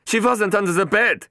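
security chief insists to angry boss; insisting Klein. A narrator's reading. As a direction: stand your ground nervously. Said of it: "Klein insisted".

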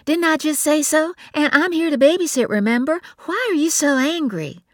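confused why her sister is upset; puzzled Bree. A narrator's reading. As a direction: sister defending her compliment. Bree voiced by narrator.

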